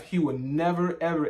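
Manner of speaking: ordinary talk, nothing marked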